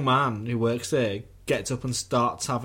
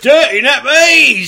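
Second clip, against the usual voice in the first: much higher, loud, slow shout; mock shout; 18 dB louder, slower than usual. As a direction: bellow it in mock horror. Mock echo of the review.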